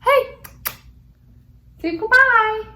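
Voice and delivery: talking to her dog, high and sing-song. Real, not acted. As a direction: coax the dog to say goodbye. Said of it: "say bye to my dog".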